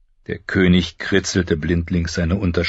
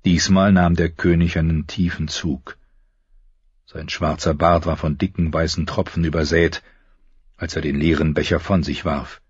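ordinary talk, nothing marked

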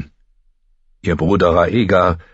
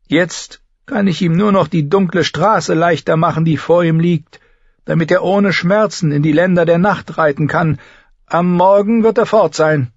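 high, loud character voice; stern healer delivering bad news; blunt healer woman; 13 semitones higher, 5 dB louder than usual. A narrator's reading. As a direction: pronounce a grim verdict without softening it.

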